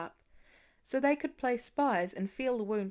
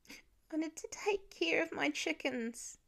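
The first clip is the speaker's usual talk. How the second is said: put-on high child's voice, a little softer; child explaining to his dad; small boy; 7 semitones higher, 4 dB quieter than usual. A narrator's reading. Child voice in story.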